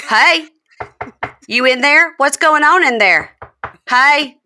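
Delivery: high, loud calling through a door; mock hey-are-you-in-there call. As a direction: call out to someone behind a door. Andy calling at bedroom door.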